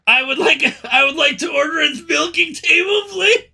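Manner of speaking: high, loud order dissolving into laughter; laughing mock request. Real, not acted. Streamer jokingly ordering a milking table, laughing hard. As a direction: shout the request while cracking up.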